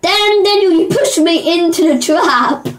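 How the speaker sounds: high, slow whiny accusation; whiny complaint. Real, not acted. Complaining at teammate.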